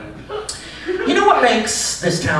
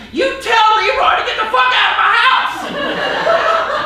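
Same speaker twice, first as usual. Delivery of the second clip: screaming profanity. Retold or imitated. Man with a slice outside Localize screaming at the phone. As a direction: bellow it like a street meltdown. Pizza guy yelling outside shop.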